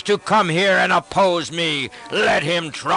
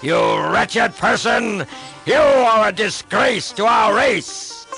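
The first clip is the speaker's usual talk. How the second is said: furious demon king roaring at his brother; enraged king denouncing a traitor brother; raging shout; 5 semitones higher than usual. Acted. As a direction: bellow with contempt, a scornful laugh mid-rant. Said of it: "and he shouted".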